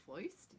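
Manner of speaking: "Foist" as a question, rhyming with moist; oy replacing the R in "Foist"; New York oy. Shown as a demonstration. Teacher imagines a puzzled movie watcher, New York oy. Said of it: "we're gonna say foist".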